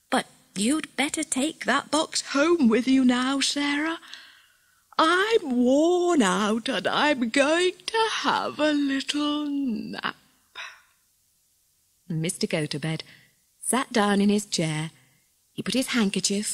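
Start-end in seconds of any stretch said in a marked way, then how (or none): 0.1-10.2 high, drawn-out yawn through the words; sleepy yawning. A narrator's reading. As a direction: yawn the words out, ever slower. Old man worn out, dozing off.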